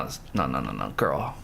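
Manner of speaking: usual speaking voice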